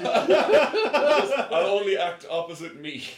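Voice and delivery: high, loud, drawn-out diva line; laughing mock demand. Joking as an actor refusing co-stars, laughing. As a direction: declare it slowly and loudly through laughter.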